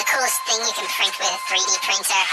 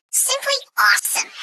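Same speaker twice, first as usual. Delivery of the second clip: excited; 12 semitones higher than usual. Real, not acted. Enthusing about 3D printed models.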